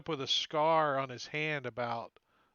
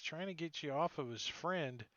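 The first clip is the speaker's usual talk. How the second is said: normal talking voice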